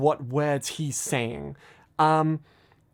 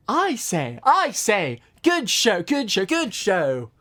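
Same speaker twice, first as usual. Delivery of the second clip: hammed-up British gentleman; put-on British voice; 3 semitones higher, slower than usual. Reciting his old pony video to viewers, mock posh British. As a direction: play a silly stuffy Englishman. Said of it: "I put on a voice and I say".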